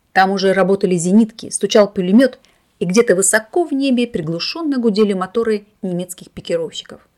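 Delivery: ordinary delivery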